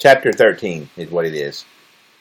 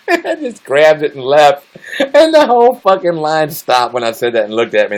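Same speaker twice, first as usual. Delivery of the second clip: higher and louder, laughing; 7 semitones higher, 3 dB louder than usual. Real, not acted. Telling how the line stared, laughing while talking. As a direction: talk through the giggles.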